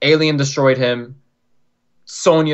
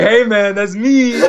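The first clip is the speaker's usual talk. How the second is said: higher hammed-up Big D voice; imitating Big D; 8 semitones higher, 3 dB louder, slower than usual. Fan retelling meeting Big D, doing a hammed-up higher Big D voice. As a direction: play Big D, pitched up and slightly louder. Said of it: "That's how he sounds too".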